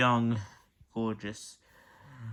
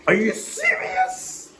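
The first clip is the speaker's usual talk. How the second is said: disbelief; 8 dB louder, slower than usual. Acted. The water cure fails.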